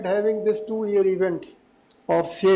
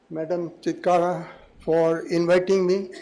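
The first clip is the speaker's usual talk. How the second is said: usual speaking voice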